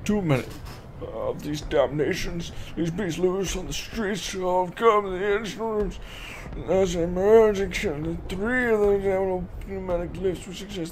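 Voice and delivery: drawn-out, declaimed note reading; theatrical read-aloud. Acted. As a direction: intone the note slowly and dramatically. Reads game note in put-on voice.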